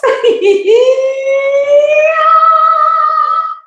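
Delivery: a long high, loud singing laugh; sung laugh. Real, not acted. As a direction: laugh it out like a note.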